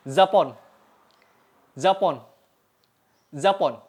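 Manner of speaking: "Zapon" with a sibilant, z-like first sound; agak berdesis. Shown as a demonstration. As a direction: say "Zapon" with the Turkish j buzzing like zh. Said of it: "dibacanya agak berdesis, mirip huruf Z". ("Zapon" dengan bunyi awal berdesis mirip z)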